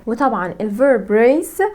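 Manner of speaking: usual speaking voice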